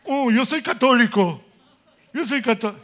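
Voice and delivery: mock his old self, laughing. Mocking his old proud self.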